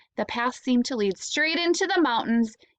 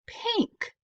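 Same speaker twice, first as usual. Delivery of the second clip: surprised high pink; 12 semitones higher, 4 dB quieter than usual. A narrator's reading. Emphasised surprise word.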